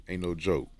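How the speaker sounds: normal talking voice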